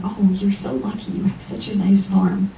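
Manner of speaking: whispered neighborly envy; envious whisper. Retold or imitated.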